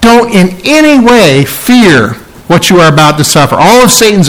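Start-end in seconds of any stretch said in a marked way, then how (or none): 0.0-2.1 "Don't in any way fear" with a punch on ANY; firm, emphatic warning stressing ANY. Real, not acted. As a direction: lean hard into ANY, lifting the pitch. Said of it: "It's very emphatic".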